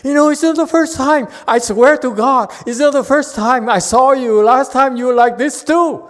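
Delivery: high, loud mock-exasperated rant; hammed-up indignant yelling, far above normal pitch; adding oil to the fire. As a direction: pile on the scolding at a comic shout. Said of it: "adds oil to the fire".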